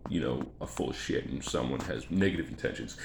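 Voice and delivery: usual speaking voice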